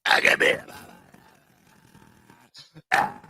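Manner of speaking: breathless whispered mobster croak; imitating the voiceless rasp. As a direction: push it out on air only. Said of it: "Sounds like a fucking cement mixer".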